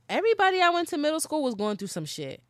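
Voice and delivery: loud, high, quick burst of realization; worked-up aside; excited emphasis. Real, not acted. Emphatic realization about middle school.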